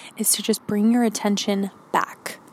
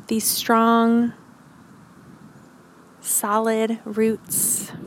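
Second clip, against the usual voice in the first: calm grounding exercise. Acted. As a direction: guide them gently into stillness. Leads grounding meditation.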